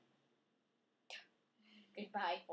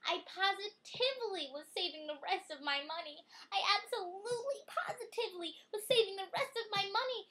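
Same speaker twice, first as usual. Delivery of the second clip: each repeat louder and higher, insisting; building insistence; 10 semitones higher, 7 dB louder than usual. Acted. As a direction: pile it on until nearly shouting. Child insisting in the story.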